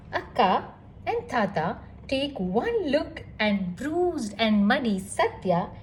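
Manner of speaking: ordinary delivery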